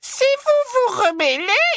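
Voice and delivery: high, sickly sweet threat; sugary cruel voice. Acted. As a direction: sweetly promise the worst. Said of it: "la voix doucereuse d'Ombrage". (voix doucereuse menaçante)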